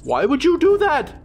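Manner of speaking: high, loud startled yell; startled shout. Real, not acted. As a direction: shout it out in sudden alarm. Sudden shock in horror game.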